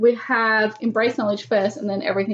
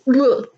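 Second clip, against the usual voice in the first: disgusted groan; 4 semitones higher, 7 dB louder than usual. Real, not acted. Disgust at the spider.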